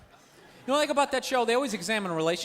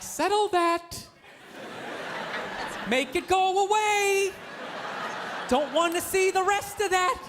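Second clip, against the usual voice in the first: slow, higher-pitched singsong self-talk; trying to ignore it; 6 semitones higher, slower than usual. Acted. Uneasy passenger talking himself out of looking, slow high pleading. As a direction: stretch it out like willing it away. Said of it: "you try to ignore it".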